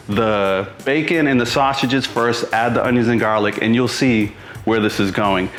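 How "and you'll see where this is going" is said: ordinary talk, nothing marked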